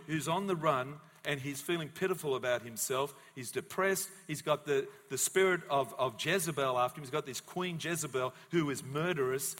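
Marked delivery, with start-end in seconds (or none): none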